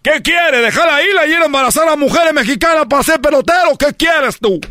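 very high ranting scold; hammed-up furious dad; scolding father. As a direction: rant at the son in disbelief. Father scolding son in story.

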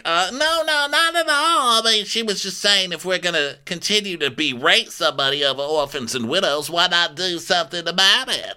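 exaggerated defensive impression; Erica. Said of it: "now Erica".

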